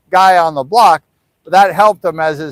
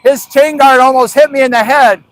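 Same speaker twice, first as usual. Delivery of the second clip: higher-pitched indignant mock yell; acted-out outraged yell; retold yelling; 6 semitones higher, 3 dB louder than usual. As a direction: imitate an angry rider's complaint, pitched up. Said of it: "Bruce is yelling".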